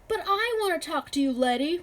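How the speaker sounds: high, loud rowdy yell. A narrator's reading. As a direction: yell it over the crowd. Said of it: "one of the farmer's sons yelled".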